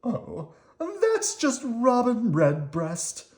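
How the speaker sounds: high, slow worm voice, casual; Squirmy unbothered. Acted. Squirmy calmly explains the robin to Slimy, in a high casual voice. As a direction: shrug it off.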